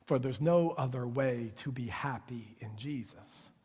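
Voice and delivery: low, soft whispered close; the believer whispering the last line of the prayer to the Father. Acted. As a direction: breathe it as a private vow. End of retold prayer.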